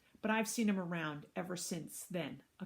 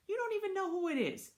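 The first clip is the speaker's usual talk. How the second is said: much higher, disbelieving objection; indignant reproach; 14 semitones higher, 4 dB louder than usual. Acted. Mom challenging Jack about the new kid, indignant. Mom argues with her son.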